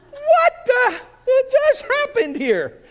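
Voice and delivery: very high and loud, stunned, slowing down on the second line; the sheep surfaces in total bewilderment; squealing baffled sheep voice; shocked sheep. Acted. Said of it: "Now imagine you're the sheep".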